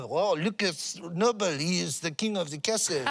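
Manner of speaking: mock French accent in English. French beachgoers admiring the builder, French accent. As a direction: impersonate French onlookers speaking accented English. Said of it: "with a French accent".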